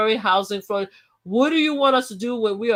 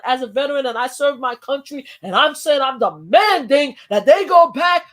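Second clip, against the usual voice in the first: building from firm to a loud, high shouted demand; hammed-up angry speaker getting louder; mock outraged citizen; 5 semitones higher, 5 dB louder than usual. Mimicking a woman at the public hearing, building to a shout.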